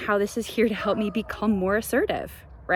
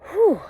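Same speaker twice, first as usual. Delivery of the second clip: high loud whew; 11 semitones higher, 8 dB louder than usual. Real, not acted. Exhales before dismissing negativity.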